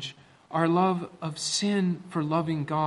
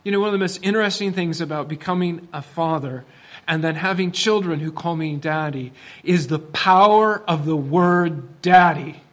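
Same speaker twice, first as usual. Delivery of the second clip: ordinary talk, nothing marked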